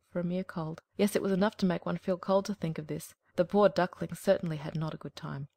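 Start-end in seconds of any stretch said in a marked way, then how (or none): none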